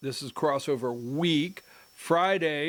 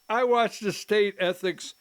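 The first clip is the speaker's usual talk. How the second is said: normal talking voice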